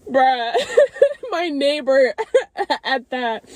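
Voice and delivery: embarrassed giggles breaking up the words; tearful laughter. Real, not acted. Telling viewers her neighbor saw her, laughing. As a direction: laugh helplessly between words. Laughing at being seen crying.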